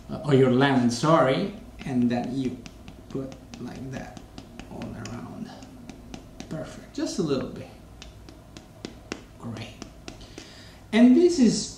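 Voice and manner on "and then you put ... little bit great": hushed asides. Real, not acted. Zesting lemon, hushed.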